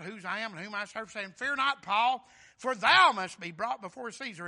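booming King James recitation, slowing on the promise; loud scripture preaching. Acted. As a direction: proclaim the verse to the congregation.

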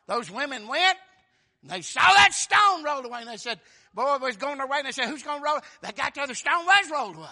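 high, loud, driving storytelling; full-force preaching. Real, not acted. Preacher telling of women at the tomb, full-force preaching. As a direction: drive the story forward loudly.

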